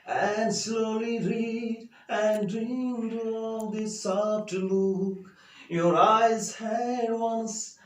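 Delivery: loud, high, slow sung-out recitation; poem recital. Shown as a demonstration. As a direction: chant the poem slowly and grandly. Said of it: "And now I will read this poem aloud for you".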